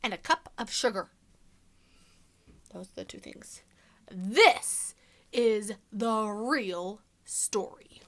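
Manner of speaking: sly wolf narrator, hushed then punchy; storybook reading. A narrator's reading. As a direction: tell it to children as the wolf, confiding and playful. Continues the wolf's story.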